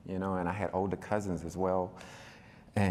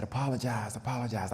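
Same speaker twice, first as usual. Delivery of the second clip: retold insistent demand; 5 semitones higher than usual. Uncle demands apology, retold.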